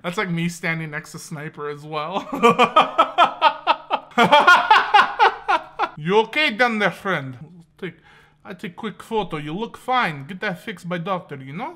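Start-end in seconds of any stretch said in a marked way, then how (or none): 2.3-5.9 high, loud peals of laughter; long laugh. Real, not acted. Laughs at sniper joke. 6.0-11.7 put-on Russian accent, halting delivery. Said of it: "I don't know why I made him Russian".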